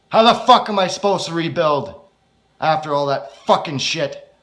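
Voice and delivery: bitter, yelling outburst; very angry. Acted. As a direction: explode at him in rage. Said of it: "I say with very, very angry".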